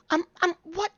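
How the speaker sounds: high, stammering, eager-to-help voice; flustered dwarf shopkeeper. Acted. Dwarf shopkeeper asking customers what they need, flustered. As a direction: stumble through it in a high fussy voice.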